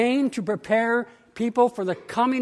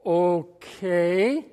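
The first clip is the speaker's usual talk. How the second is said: questioning okay; 4 semitones lower than usual. Retold or imitated. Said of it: "with a question in my voice".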